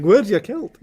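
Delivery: loud, high Scottish tease; mock Scottish accent. Teasing man with Scottish accent.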